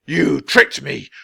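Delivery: first angry blast; angry accusation. A narrator's reading. Wizard accusing the children, slow and angry.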